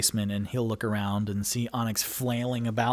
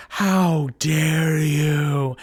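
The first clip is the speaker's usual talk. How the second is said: loud, drawn-out indignant bellow; booming, scandalized reproach; outraged threat; 4 semitones higher, 8 dB louder, much slower than usual. Acted. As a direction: stretch each word into a slow, wrathful cry. Reading a furious threatening note aloud.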